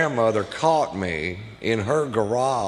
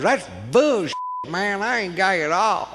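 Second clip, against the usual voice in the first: lightly voiced cousin, pitched up and defensive; higher cousin voice; 6 semitones higher than usual. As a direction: play the indignant cousin, a bit higher. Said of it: "He goes".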